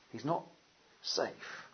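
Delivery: He's not safe, dropping to a soft near-whisper on safe; hushed. Real, not acted. Preacher telling the church God is not safe, hushed. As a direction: let safe fall away almost breathless. Echo of Narnia line.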